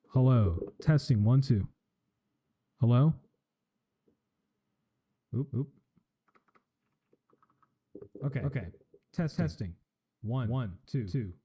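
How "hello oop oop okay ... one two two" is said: mic test through an echoing delay; mic check with echo delay; delay effect. Shown as a demonstration. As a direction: call out test words into the delay. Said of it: "a two hundred millisecond delay".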